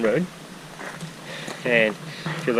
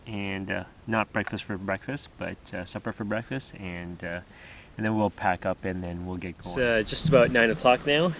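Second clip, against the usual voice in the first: ordinary talk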